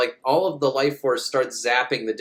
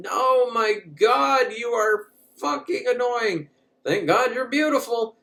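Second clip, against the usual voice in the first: high, slow put-upon voice; mock exasperated alien; 9 semitones higher than usual. Alien woman mocks the hero, high and exasperated. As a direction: roll your eyes in a high voice.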